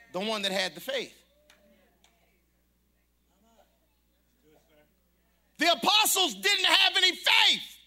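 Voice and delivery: full-throated pulpit preaching; preaching at full force. Real, not acted. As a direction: drive each phrase home to the congregation. Preaching build to climax.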